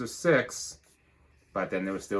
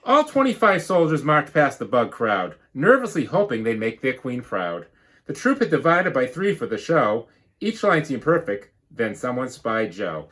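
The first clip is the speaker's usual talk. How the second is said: lively storybook read-aloud; bouncy rhyming storybook narration; reading to children; 5 semitones higher, 6 dB louder than usual. Acted. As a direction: read it like a picture book to the class. Teacher reads picture book.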